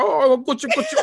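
put-on high, laughing coo at a baby; baby talk. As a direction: coo like you are holding the baby. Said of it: "I was already over here like".

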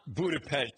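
Budapest as a laughing Buda-pesht; over-enunciated. Shown as a demonstration. Father teasing his world-traveler son, over-enunciated Budapest with sh. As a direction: exaggerate the sh in Budapest. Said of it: "we over-enunciate just to annoy him".

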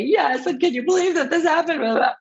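high, exaggerated gushing voice; mock dramatic overshare. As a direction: ham up the melodrama, voice soaring. Overdramatic answer to her child.

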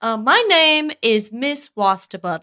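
normal talking voice

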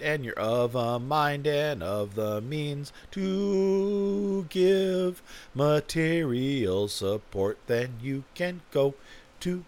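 very slow, stretched-out words; drawn-out pitch. Pretend. Podcaster asking for material support, exaggeratedly drawn out. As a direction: draw each word out theatrically.

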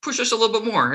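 high, mocking voice for the bosses; mock staff voice. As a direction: play the site staff as clueless. Quoting site staff, "they're like.

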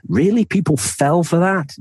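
high-pitched, incredulous question; mock disbelief. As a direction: marvel that anyone could be fooled. Imagined future disbelief at scams.

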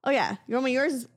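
ordinary talk

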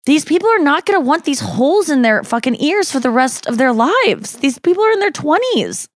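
mock outraged, pitch soaring to the end; incredulous rant. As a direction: climb to an exasperated squeak. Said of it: "And I go".

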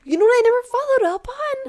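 excited. Real, not acted. Suddenly remembers something.

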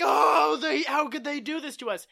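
exaggerated imitation of someone distraught; mock distress. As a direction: wail it in hammed-up anguish. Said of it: "He's, like, not distressed".

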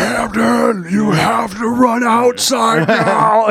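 high, slow, urgent put-on warning; mock urgent caller. Looper on the phone urges him slowly and urgently. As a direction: plead like a looper from the future. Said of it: "it's always like".

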